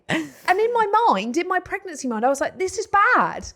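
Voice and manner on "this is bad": high-pitched, appalled inner voice; mock horrified disgust. Mum reacting inwardly to fingered food, horrified. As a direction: replay your outrage, pitched right up.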